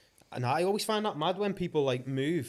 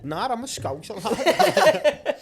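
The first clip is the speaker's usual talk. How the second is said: hammed-up Scouse accent, rising high and loud on the last word; put-on Scouse; 5 semitones higher, 7 dB louder, much slower than usual. Mate proving how strong he can make it, exaggerated Scouse. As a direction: lay the Scouse on thick, half laughing. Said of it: "Just see how strong I can make it".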